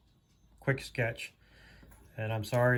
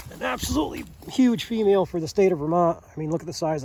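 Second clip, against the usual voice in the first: excited; 5 semitones higher, 5 dB louder than usual. Real, not acted. Marvels at huge bobcat.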